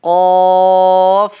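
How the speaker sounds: কফ held and loud; long loud qaf. Shown as a demonstration. Teacher stretching কফ for students. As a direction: draw কফ out for three alif. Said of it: "টান দিতে হবে তিন আলিফ".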